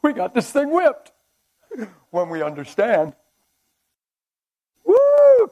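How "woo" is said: loud high whoop of joy; excited whoop. Real, not acted. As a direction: let out a joyful holler. Preacher fired up.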